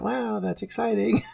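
sarcastic, high "wow, that's exciting"; a boy pretending to be thrilled by his grandfather's joke; mock excitement. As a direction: fake enthusiasm, tongue in cheek. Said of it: "And I went".

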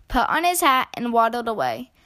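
plain reading voice

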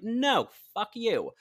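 high, loud, drawn-out mock outburst; defiant refusal. Campaigner rebuffing friends worried about her health, mock defiant refusal.

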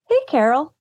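imitating toddler. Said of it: "points and goes".

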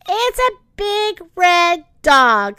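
high, slow, amused voice; Cleo voice. Acted. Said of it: "Cleo said".

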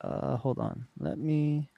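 muffled, as if hand over mouth; muffled mic. Real, not acted. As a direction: sound muffled and weird. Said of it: "you sound, like, muffled and weird now".

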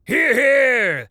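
high, loud crowd cheer; shouting. Acted. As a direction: cheer it out for your side. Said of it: "shouted Talarn's faction".

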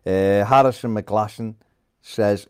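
ordinary delivery

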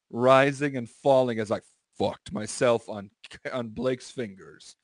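ordinary talk

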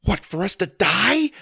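high, loud panicked retort; freaking out. Acted. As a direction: throw the question back in alarm. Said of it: "start to freak out".

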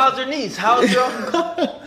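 high, slow teasing fake politeness; mock nosy chitchat. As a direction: ham up a nosy small-talker. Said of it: "He's like".

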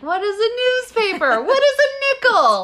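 high baffled mock voice; confused youngster. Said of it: "people listening being like".

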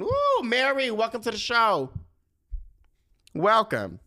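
sung-out call, high and loud; shouting. Acted. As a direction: call out the welcome like a showman. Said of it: "I don't know why I'm shouting".

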